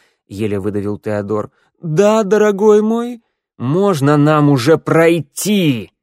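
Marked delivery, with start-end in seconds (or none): 1.8-5.9 high, loud outburst; loud blurt. A narrator's reading. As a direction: blurt it out with force. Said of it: "выпалил Тео с такой мощью". (громкий выкрик)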